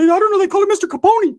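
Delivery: high cartoonish homeboy; high-voiced homeboy. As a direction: answer in a tiny falsetto. High voice of figure in exchange.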